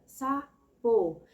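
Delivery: sapo split as SA... PO, a clear gap between; syllable by syllable. Shown as a demonstration. Child's answer in a syllable exercise, sapo broken syllable by syllable. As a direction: say "sapo" in two slow, separate syllables. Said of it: "Quantas vezes você abre a boquinha".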